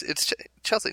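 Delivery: whispered Chelsea as a hasty misread; quick misreading. Shown as a demonstration. Speaker shows how the name gets misread, hushed. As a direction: breathe out Chelsea like a first glance. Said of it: "at first glance".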